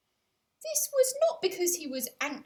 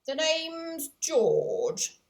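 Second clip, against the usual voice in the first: louder, slow, self-important working-class man; pompous shopkeeper George; 6 semitones higher, 8 dB louder, much slower than usual. Acted. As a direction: puff up and announce yourself grandly. Shopkeeper George introduces himself.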